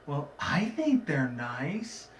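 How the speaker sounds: very slow, shy little voice; a shy pony giving a timid opinion; timid Fluttershy. Retold or imitated. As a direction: say it meekly, drawn right out. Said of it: "It's like".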